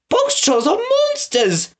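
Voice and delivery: high alarmed accusation; alarmed townswoman. A narrator's reading. Townswoman condemns the box trolls, high scandalised cry. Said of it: "called the woman".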